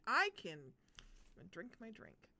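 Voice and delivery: ordinary delivery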